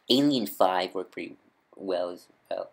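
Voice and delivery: usual speaking voice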